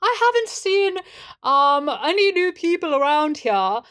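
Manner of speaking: put-on high, halting, overdone British accent; wobbly fake British accent. [name] hunts for his voice while reporting a letter, British accent. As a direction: restart until the stagey British voice catches. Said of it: "the accent, and it keeps evading him".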